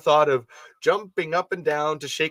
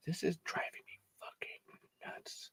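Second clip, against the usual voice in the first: whisper; 3 semitones higher, 18 dB quieter than usual. Real, not acted. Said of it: "whispered aside".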